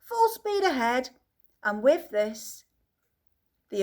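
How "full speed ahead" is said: shouting. A narrator's reading. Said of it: "shouted Mister Wonka".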